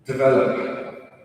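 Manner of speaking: slow, careful American-accented demo; neutral American accent. Shown as a demonstration. Coach showing the correct word, neutral American accent. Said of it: "American or someone with a neutral English accent".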